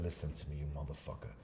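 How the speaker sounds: low, soft retold warning; quiet threat. Man warning the stranger in his face, quietly threatening. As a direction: keep the menace down low.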